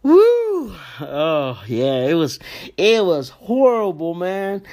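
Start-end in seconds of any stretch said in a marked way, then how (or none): 0.0-0.8 high, loud whoop; excited woo. Real, not acted. Shaking off the heartbreak story with a loud woo. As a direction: let out a sudden whoop. Exclaims after the sad story.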